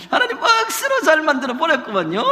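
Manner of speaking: high, laughing Gyeongsang dialect. Shown as a demonstration. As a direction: ham up the Gyeongsang lilt through laughter. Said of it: "경상도 말로 하면".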